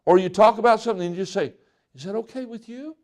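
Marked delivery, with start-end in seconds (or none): 1.9-2.9 soft, timid question to avoid conflict; meek pleading tone. Shown as a demonstration. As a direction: ask it very softly, eager to please. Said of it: "You hear the tone that I'm talking about".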